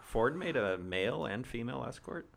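normal talking voice

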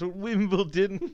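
slow, drawn-out Wimbledon with a plummy lilt; posh English accent. Shown as a demonstration. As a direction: say "Wimbledon" like a stuffy butler. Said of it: "Do your English accent again?".

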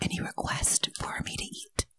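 low, whispered offer; hushed mock-ASMR question. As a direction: whisper it to the listeners. Performing ASMR to the group.

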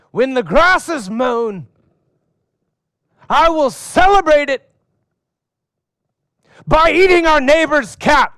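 put-on a kid proclaiming a ridiculous oath; pompous son. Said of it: "one of my sons says to me".

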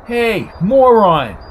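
high, loud drawn-out yell; loud, slow-stretched insulting shout. A narrator's reading. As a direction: yell it out as a taunt across the street. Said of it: "yelled Coco".

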